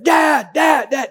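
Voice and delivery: put-on high-pitched kid calling out repeatedly; imitated child's yell. Boy running to the other side calling dad, high imitated shout. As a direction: mimic a gleeful boy crying out from afar. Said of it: "He just loved to cry out".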